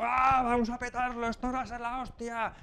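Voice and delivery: arranque agudo y animado, como venirse arriba; estudiante eufórico. Retold or imitated. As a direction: imita a alguien que se motiva a gritos. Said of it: "gente que se potencia unos a otros". (estudiante eufórico animando al grupo)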